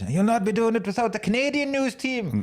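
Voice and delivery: put-on high-pitched comic Canadian accent; Jim Carrey's Canadian accent. Film fan quoting the Anchorman Two news-team battle, Canadian accent. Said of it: "the accent that Jim Carrey does".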